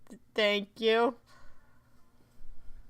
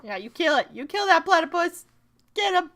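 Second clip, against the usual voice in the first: loud, higher cheer; excited cheering; 7 semitones higher, 10 dB louder than usual. Real, not acted. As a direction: egg them on loudly. Cheering on in game.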